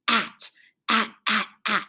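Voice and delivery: "at" repeated in short, separate beats; isolated ending part. Shown as a demonstration. Teacher sounds out what's left, "at" in separate beats. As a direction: chant "at" crisply, a gap after each. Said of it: "both end in at".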